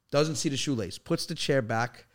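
ordinary talk

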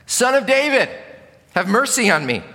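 loud, high cry for mercy; shouted plea. Acted. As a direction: call out from the roadside, high and loud. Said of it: "he just shouted all the more".

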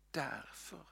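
soft breathy whisper, rising at the end. Shown as a demonstration. Preacher whispers the answer to the congregation. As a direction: hush it like a secret answer.

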